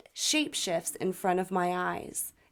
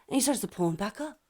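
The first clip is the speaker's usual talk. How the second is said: ordinary talk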